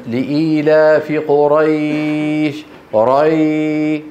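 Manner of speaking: قريش with the "ay" glide held very long; easy-flowing leen. Shown as a demonstration. Teacher shows how easily the sound flows, sustained leen. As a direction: recite slowly, stretching ري on a level tone. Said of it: "بسبب سهولة جريهما في المخرج".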